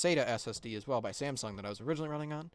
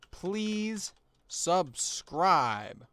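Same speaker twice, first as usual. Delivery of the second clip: very slow, high and loud; drawn-out please subscribe; 5 semitones higher, 6 dB louder, much slower than usual. Acted. Says button text, drawn out.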